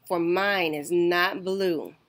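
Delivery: plain reading voice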